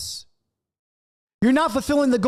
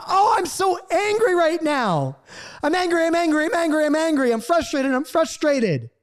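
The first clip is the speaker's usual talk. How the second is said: controlled anger; 8 semitones higher than usual. Shown as a demonstration. Parent letting anger flow out safely, high controlled venting. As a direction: let frustration flow out in a high, insistent stream. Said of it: "what about controlled anger? What about let it".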